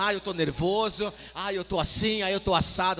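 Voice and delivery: loud, hammed-up complaining; playacted exasperated rant; mock nervous outburst. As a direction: wail loudly as if you've had enough. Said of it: "nervoso mesmo, sabe? Ô, nervoso".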